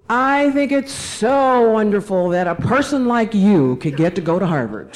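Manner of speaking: mock loud gushing, pitched high then dropping off; imitating loud voice. Classmate to her after the two-hour class, mock loud voice. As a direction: imitate a classmate announcing it for everyone to hear. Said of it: "in a very l-, loud voice".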